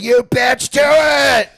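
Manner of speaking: high, loud daring yell; imitated goading shout. Tony goading Bruce to throw, imitated shout. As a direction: yell the dare at full voice.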